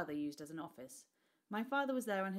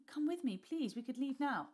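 higher, louder desperate plea; pleading; 8 semitones higher, 4 dB louder than usual. A narrator's reading. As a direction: beg him, voice rising high. Son begs father to flee.

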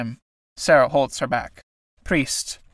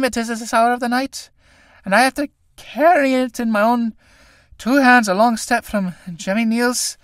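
stiff awkward speech; 7 semitones higher than usual. A narrator's reading. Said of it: "in a stilted voice".

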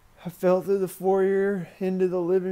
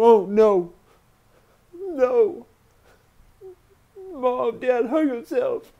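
loud, raised, anguished outcry; 6 semitones higher, 7 dB louder, slower than usual. Retold or imitated. Child telling mother the terrible news, anguished.

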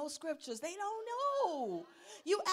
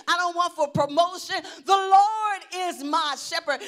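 loud, raised preaching shout; forceful decreeing; 4 semitones higher, 12 dB louder than usual. Shown as a demonstration. Believer decreeing the Lord is my shepherd, shouted. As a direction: declare it at full force, each line hammered. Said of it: "You should be decreeing".

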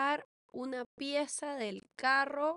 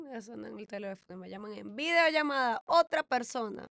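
normal talking voice

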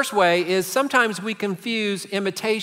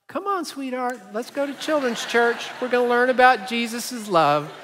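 fake sweet parent; 4 semitones higher than usual. Parent after yelling in the car, now mock sweet to the kid. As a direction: sweet-talk the kids as if nothing happened.

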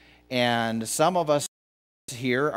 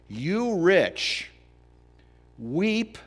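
rising dramatic reading; 8 semitones higher, 4 dB louder, much slower than usual. Acted.

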